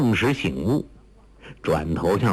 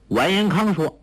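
plain reading voice

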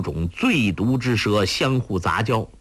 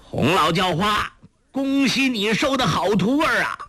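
raised, much higher mocking shout; loud call; 11 semitones higher than usual. A narrator's reading. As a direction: call out across the bamboo grove with a sneer. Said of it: "高声的叫道".

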